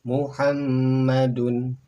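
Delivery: "Muhammadun" very slow and loud, heavy doubled m; model Arabic reading. Shown as a demonstration. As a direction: drag out "Muhammadun" for copying. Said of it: "Berikutnya silakan". ("Muhammadun" dibaca pelan, mim ditasydid)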